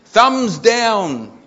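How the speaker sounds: loud, high proclamation; loud mock complaint. A narrator's reading. Customer reviews chip shop on Facebook, loud mock complaint. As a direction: call it out in mock outrage.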